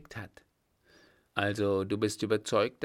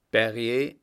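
louder call into the dark; 9 dB louder than usual. A narrator's reading. Vagabond hailing Berrier, calling out. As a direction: call his name to the approaching rider. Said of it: "rief einer".

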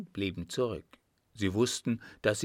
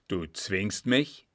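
angry; slower than usual. A narrator's reading. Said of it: "fragte der Scheik zornig".

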